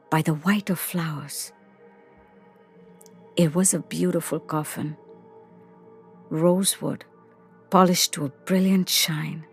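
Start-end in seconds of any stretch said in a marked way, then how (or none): none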